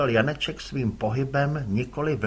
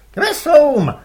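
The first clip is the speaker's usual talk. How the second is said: much higher, loud roar; roaring order; 12 semitones higher, 12 dB louder than usual. Acted. Rival father roars to the oars, loud yell. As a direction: roar the command.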